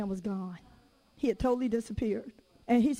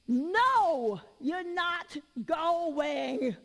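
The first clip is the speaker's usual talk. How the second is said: higher, drawn-out mock refusal; retold fussing; 4 semitones higher, much slower than usual. Mother refusing her persistent daughter, retold fussing. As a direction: fuss it out, stretching the words. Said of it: "So I just fussed".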